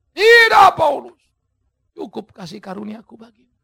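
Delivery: loud firm refusal that settles down; God saying no, steady and sure; God answering. Acted. (jawaban Tuhan yang tegas)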